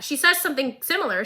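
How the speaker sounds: ordinary talk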